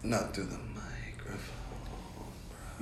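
hushed, off-mic voice; away from the mic. Shown as a demonstration. Guest arguing about mic distance, away from the mic. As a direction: drop to a near whisper off the mic. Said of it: "from way over here".

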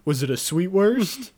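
high, loud comic exasperation; worked-up mock outrage; incredulous rant. Real, not acted. Comic outrage at the sausage detail.

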